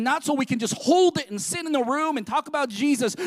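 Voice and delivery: ordinary talk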